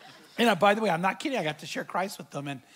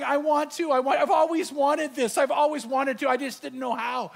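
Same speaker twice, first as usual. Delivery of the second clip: higher, mildly tearful imitation; a hint of happy tears, pitched up; retold tearful joy; 6 semitones higher, 3 dB louder than usual. Woman at the kitchen table finally ready, mildly tearful. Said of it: "she starts crying".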